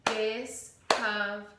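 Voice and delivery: "Discovery" slowly as dis-cov-er-y; syllables chopped. Shown as a demonstration. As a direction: chop "Discovery" into clear chunks. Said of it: "Try to do it with me".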